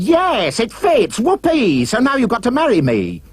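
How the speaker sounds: high-pitched triumphant cry; screamed. A narrator's reading. Ugly sister claims the prince, screaming. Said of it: "But she screamed".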